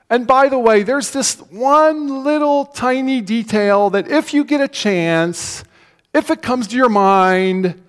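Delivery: high, overly gentle understatement; sugar-coat it like a timid hint; mock soft-pedalling. Preacher mocking a too-gentle Jesus, high and coy. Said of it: "That's not what he said".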